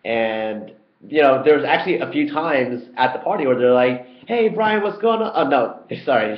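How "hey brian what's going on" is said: high, bright imitation of a friend's hello; cheery greeting from a stranger. A stranger at the party greets him brightly. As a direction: play the overfriendly college buddy.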